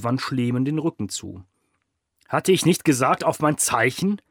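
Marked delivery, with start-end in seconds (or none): 2.3-4.2 loud, higher, commanding reprimand; barking rebuke. A narrator's reading. Boss berating his two Italian henchmen, barking. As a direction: dress down the clumsy henchmen. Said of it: "herrschte er die beiden Italiener an".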